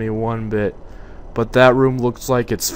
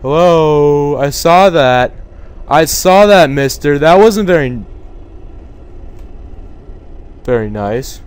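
loud, higher challenge to someone hiding; calling out; 3 semitones higher, 8 dB louder than usual. Real, not acted. Player calls out to a figure that fled, loud teasing challenge. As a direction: call him out loudly, half teasing.

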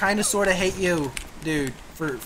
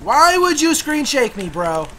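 higher, louder outraged question; indignant protest; 9 semitones higher, 7 dB louder than usual. Real, not acted. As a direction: call him out. Annoyed at teammate.

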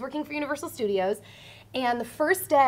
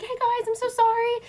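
much higher-pitched, light voice; imitated latecomer's apology; 11 semitones higher than usual. Late girl running up to the waiting group, high apology. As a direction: play the apologetic late arrival.

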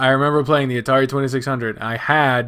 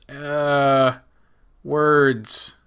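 ordinary talk

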